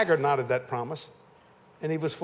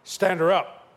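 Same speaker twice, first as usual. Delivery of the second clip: louder, insistent repeat of the order; insistent command; 3 semitones higher, 6 dB louder, slower than usual. Retold or imitated. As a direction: press the order home. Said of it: "he said".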